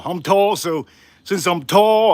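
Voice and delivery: ordinary talk, nothing marked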